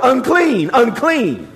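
lightly called out "Unclean, unclean" like a leper's warning; a hint of calling out "Unclean" to the crowd; mild cry of warning. Leper warning people he approaches, mild call-out. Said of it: "they had to cry out".

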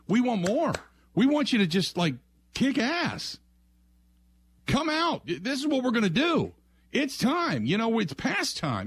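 rally the coach like a fed-up fan; fired-up fan calling for swagger; rousing fan plea. Retold or imitated. Fans begging for fire.

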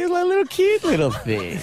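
very high-pitched cooing over a cub; cutesy gushing. Real, not acted. Owner defends his baby tiger to radio hosts, cutesy and high-pitched.

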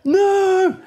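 high, loud cry of dread; horrified no. Retold or imitated. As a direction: howl it in disbelief. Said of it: "And we're like".

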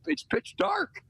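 milk the complaint with disbelief; mock outrage. Real, not acted. Griping about dark mornings.

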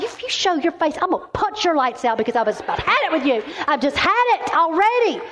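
high-pitched, mock-angry warning; fed-up threat. Woman threatening the enemy to its face, mock fed-up anger. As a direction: threaten like you've truly had enough, playfully. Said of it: "I was so mad at the enemy".

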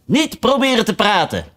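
high urgent shout; Red Queen calling harder harder. Acted. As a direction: urge her on, calling out. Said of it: "want die riep".

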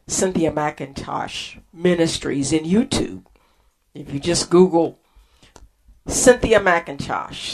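ordinary talk

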